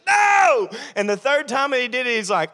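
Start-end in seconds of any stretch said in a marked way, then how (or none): 0.1-0.7 very high, loud no; panicked shout. Acted. Peter's third denial, high panicked yelp. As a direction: yelp it desperately.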